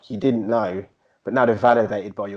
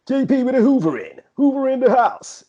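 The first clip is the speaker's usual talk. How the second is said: put-on high, loud old school garage MC patter; 6 dB louder than usual. Shown as a demonstration. Narrating his own hoovering, garage MC voice. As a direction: chant it like a garage MC. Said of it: "I do it with the M C voice".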